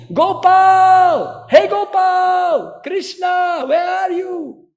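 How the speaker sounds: high, loud calls into the forest; loud calling out. Retold or imitated. Thief shouting for Gopal in the forest, loud high calls. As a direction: yell the name across the jungle. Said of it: "started to scream loudly".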